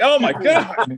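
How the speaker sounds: loud, high laughing oh my God; laughing disbelief. Retold or imitated. Dad stunned by his son's cursing, laughing loudly. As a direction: crack up at what the kid said. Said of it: "I'm like".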